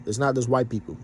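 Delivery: usual speaking voice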